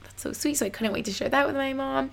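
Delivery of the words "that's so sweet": breathy whisper, much softer, as if moved; whispered aside. Retold or imitated. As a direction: hush it like a private aside. Touched reply during psychic reading.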